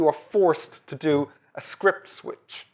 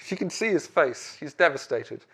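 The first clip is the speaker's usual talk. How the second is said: ordinary delivery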